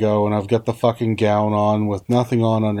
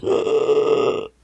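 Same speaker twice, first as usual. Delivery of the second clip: strained voiceless gasp of pain; acted-out pained gasp; re-enacted gasp; 2 semitones higher than usual. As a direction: imitate gasping from a sharp hurt. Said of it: "I, I gasped".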